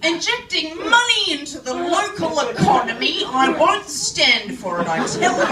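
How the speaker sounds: loud, hammed-up indignant rant; hillbilly rant. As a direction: rail at the foreigners in mock outrage. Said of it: "I'm showing my hillbilly roots".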